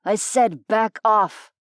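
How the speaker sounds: slow, forceful threat; angry warning. A narrator's reading. Human jerks his arm free, angry warning. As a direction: bite out each word slowly.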